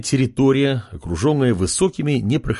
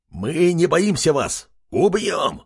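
shouting pig creature; 5 semitones higher, slower than usual. A narrator's reading. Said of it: "выкрикнул один из свинов".

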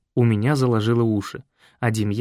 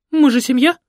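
high, loud singsong plea; sing-song; 13 semitones higher, 7 dB louder than usual. A narrator's reading. Mother cooing to Fred that they're family. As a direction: croon it sweetly. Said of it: "пропела матушка".

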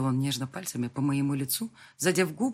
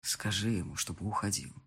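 low quiet order; 7 semitones lower, 6 dB quieter than usual. Acted. Lover ordering her from behind, low and quiet. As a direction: lower and softer, commanding.

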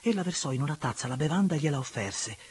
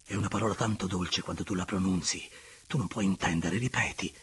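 low, intimate man's character voice; deep-pitched tender coaxing; Andrea, entreating lover; 6 semitones lower than usual. Acted.

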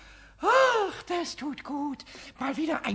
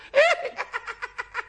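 giggling kobold laugh; 10 semitones higher than usual. Acted. Pumuckl giggles at his own prank, high giggle. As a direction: burst into cheeky giggles.

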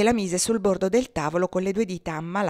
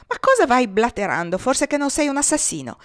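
angry retort; 5 semitones higher, 4 dB louder than usual. A narrator's reading. Joe berates Babalù.

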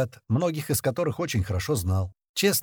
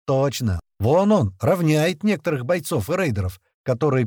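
plain reading voice

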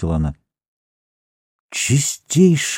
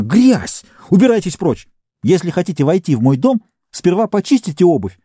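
indignant scolding; 7 semitones higher than usual. Acted. Housewife chases out muddy guest.

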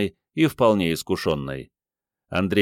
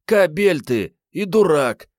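high, loud, slow commanding woman; army-command tone; 10 semitones higher, 5 dB louder, slower than usual. A narrator's reading. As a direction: bark it like an army order. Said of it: "армейско-командных интонаций". (армейско-командным тоном, высоко и громко)

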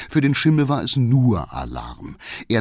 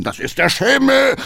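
high, loud call over his shoulder; 5 dB louder than usual. Acted. Coachman calls back to the councillor, shouting. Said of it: "rief Hackendahl".

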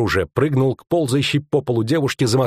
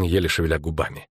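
usual speaking voice

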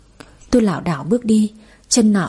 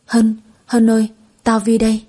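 choked up. A narrator's reading. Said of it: "Tôi không nói được rõ chữ, cổ họng nghẹt ứ".

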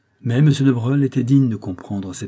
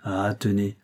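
ordinary delivery, nothing marked

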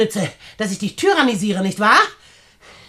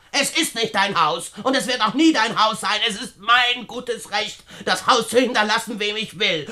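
usual speaking voice

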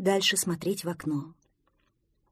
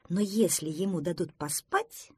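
ordinary delivery, nothing marked

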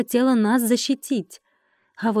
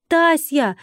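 high, raised searching shout; calling out; 6 semitones higher, 4 dB louder than usual. A narrator's reading. Heroine calls for captive friend, raised shout. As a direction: call for a missing friend. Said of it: "крикнула я".

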